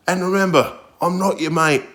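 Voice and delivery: high, mocking imitation of a stern judge. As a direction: squeak the judge's warning back in mockery. Judge's parting warning retold.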